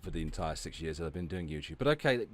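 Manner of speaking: ordinary talk, nothing marked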